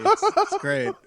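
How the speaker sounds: high laughing sarcasm. Real, not acted. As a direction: laugh through the words.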